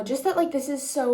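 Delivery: ordinary talk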